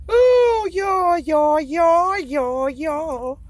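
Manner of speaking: high, loud excited call to y'all; loud, high excited hailing. Real, not acted. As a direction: call out to the viewers with a rush of excitement. Excited calling out to viewers.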